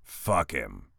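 low, soft growled dismissal. Acted. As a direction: spit it out with contempt, low. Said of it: "Brick growled".

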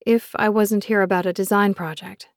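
usual speaking voice

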